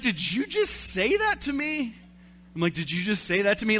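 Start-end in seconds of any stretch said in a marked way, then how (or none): 0.0-1.9 much higher, louder, slow indignant disbelief; shocked wife. Retold or imitated. Wife to husband after his mom joke, high shocked disbelief. 2.7-3.8 mocking the line back like a three-year-old, a bit louder; childish echo. Husband mimicking his wife, lightly childish mocking echo. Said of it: "I reverted back to, like, a three-year-old".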